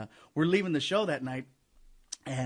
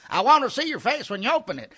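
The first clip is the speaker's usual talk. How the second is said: higher, louder pleading demand; heckler insisting; 10 semitones higher, 6 dB louder than usual. Retold or imitated. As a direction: push him to open it now. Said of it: "he's yelling at me".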